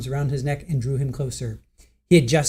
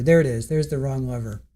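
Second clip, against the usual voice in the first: usual speaking voice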